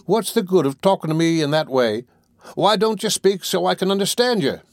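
high, complaining newcomer's voice; Boulter, irritated. Acted. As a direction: complain loudly about being kept in the dark.

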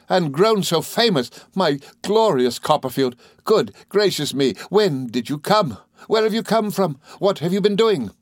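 high, gushing gentleman, amazed and delighted; effusive, excited, pitched well up; excitable friend. A narrator's reading. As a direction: pile up eager questions to an old friend.

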